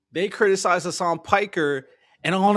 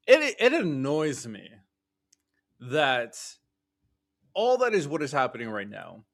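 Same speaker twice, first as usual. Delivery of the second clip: usual speaking voice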